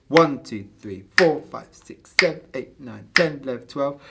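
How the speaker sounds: counting twelve eight in groups of three; accented compound count. Shown as a demonstration. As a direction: punch the start of each group of three. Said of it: "compound beats are when they're split into three".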